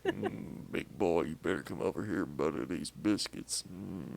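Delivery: hammed-up flirt calling her man over; mock sultry come-on. Flirty invitation about buttering biscuits.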